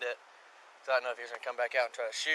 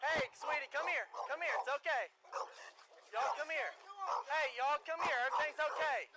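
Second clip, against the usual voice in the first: coaxing sweet voice; 12 semitones higher, 6 dB quieter than usual. Real, not acted. Officer coaxing the children out.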